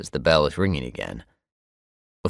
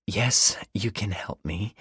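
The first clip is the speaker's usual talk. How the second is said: slow, husky and raspy, just woken; 5 semitones higher, slower than usual. A narrator's reading. Neighbor at the door, husky and raspy. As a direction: drag it out in a husky rasp. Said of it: "she says in a husky, raspy voice".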